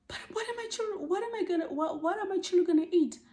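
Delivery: pleading nanny. Retold or imitated. Fired nanny asks her boss how her children will eat, high and panicked. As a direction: squeak it out as the desperate nanny.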